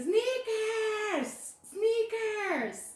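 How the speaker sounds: high, loud, drawn-out yell; stretched-out exasperated holler; exasperated shout. Acted. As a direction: impersonate a fed-up dad yelling the answer. Exasperated dad yells the answer again.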